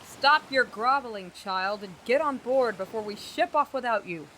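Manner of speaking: put-on exasperated captain yelling at the girl; angry shout. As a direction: cut off the groveling with force. Captain snaps at groveling acolyte.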